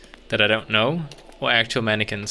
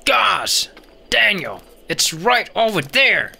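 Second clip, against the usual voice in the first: high, teasing mock viewer voice; pitched-up exasperated imitation; 9 semitones higher, slower than usual. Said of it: "You're like".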